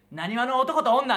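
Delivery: drawn-out, loud, high stage call; slow hammed-up stage announcement; mock stage announcement. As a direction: belt it out like announcing a comedy act. Imitating Elekitel's act opener.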